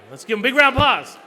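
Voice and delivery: loud, fast call for applause; rallying crowd. Real, not acted. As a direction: pump up the room.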